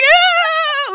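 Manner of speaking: very high, long "ew" shriek; disgusted squeal. Retold or imitated. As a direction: squeal as if fleeing something revolting. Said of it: "I'm just so disgusted".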